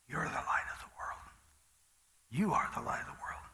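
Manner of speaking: soft unvoiced whisper of a gentle voice. God whispering his reminder to the preacher. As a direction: hush it like a secret truth.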